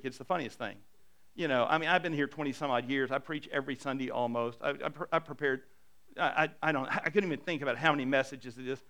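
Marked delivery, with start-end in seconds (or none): none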